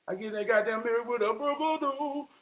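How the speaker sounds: mock friend voice over the boo-boo-doo; imitating the friend. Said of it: "That's how he talk".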